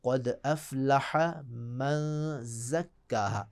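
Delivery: drawn out slowly for the student; slow teaching read; slow, careful demo. Shown as a demonstration. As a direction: spell out each sound of ज़क्काहा slowly. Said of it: "इंतहाई अहम आयत मैं बता रहा हूँ".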